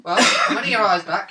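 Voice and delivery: normal talking voice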